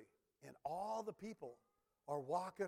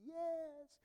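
a much higher, drawn-out church-lady yes; church women's yes; 12 semitones higher than usual. Retold or imitated. Preacher imitates women calling out yes in the pews, high. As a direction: answer the preacher like an old church mother. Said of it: "The women would pull their hanky out".

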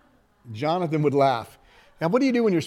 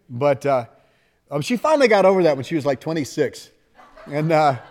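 ordinary talk

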